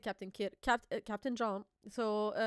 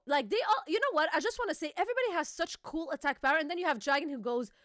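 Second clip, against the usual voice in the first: usual speaking voice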